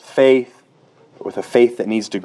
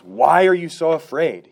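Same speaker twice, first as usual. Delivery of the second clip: Jesus rebuking; 7 semitones higher than usual. Pretend. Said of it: "he says".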